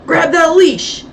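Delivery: high loud shout. A narrator's reading. Thorne yelling at the dog handler, loud shout. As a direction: yell the order across the dark. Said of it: "shouted Thorne".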